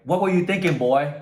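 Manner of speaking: sergeant screaming. Retold or imitated. Sergeant screams at one recruit, high loud yell. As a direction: scream it right in the recruit's face. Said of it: "he screams at him like".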